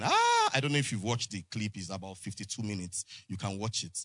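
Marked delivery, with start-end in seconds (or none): 0.0-0.5 high, loud "ah"; sudden high-pitched crowd yell; imitated crowd outcry. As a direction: cry out like a roused congregation. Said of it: "People were laughing".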